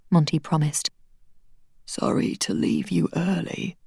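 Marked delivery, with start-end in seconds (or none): 1.9-3.8 softer, whispered apology; fading last breath; dying man apologising to his friend, whispering; faint whisper. Acted. Dying man's farewell.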